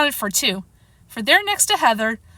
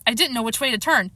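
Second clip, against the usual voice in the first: plain reading voice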